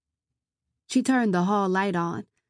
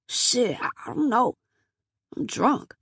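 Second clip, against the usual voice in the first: put-on drunk man voice; 5 semitones higher than usual.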